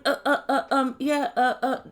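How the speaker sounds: choppy, repeated hesitation noises; mock evasive stammer. Binyam mimics Leandro to Ariella, mock evasive stammer. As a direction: imitate a bad liar stalling for words. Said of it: "he tried to lie to me".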